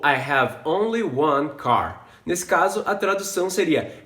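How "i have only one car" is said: slow clear demo. Shown as a demonstration.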